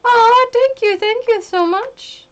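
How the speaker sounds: high, loud gushing thank you. Real, not acted. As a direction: gush with delight.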